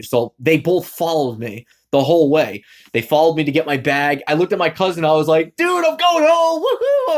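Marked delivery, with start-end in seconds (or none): 5.6-7.2 high, slow, excited whoop; gleeful shouted woohoo; happy cheer. Retold or imitated. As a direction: cheer like you just got free. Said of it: "I was all happy".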